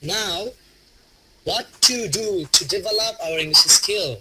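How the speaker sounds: slow, "Now" stressed to open the question. Shown as a demonstration. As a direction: lean on "Now", then glide slowly through the rest. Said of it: "now দিয়ে জোর দিয়ে বলতে হবে".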